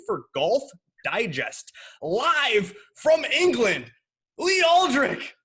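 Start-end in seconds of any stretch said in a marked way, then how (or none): 2.0-5.3 slow, rising, loud announcer intro, name climbing high; grand guest announcement. Acted. Host announces his English guest, loud and high. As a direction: stretch it out like a ring announcer.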